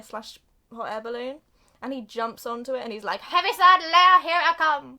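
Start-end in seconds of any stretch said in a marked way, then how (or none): 3.2-5.0 loud, high, hammed-up battle cry; triumphant villain shout. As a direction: shout it as you leap. Villain leaps for the balloon.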